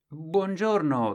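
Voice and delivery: high, slow, sing-song greeting; a hint of a cheerful caller; lightly voiced caller. Other man greets on phone.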